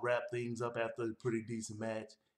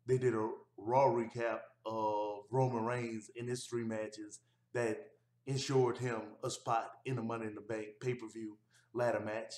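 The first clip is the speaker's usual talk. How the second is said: normal talking voice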